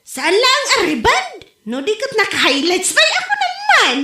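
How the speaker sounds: high laughing brush-off. Real, not acted. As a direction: laugh off the question. Wife laughs at husband's question.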